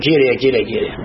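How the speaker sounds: low, quick echo of a prayer call; imitated invocation, dropped right down in pitch; dismissive echo. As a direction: repeat "Κύριε" low, without calling out. Quotes the cry back in reproof.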